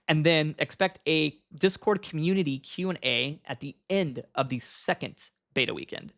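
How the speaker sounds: usual speaking voice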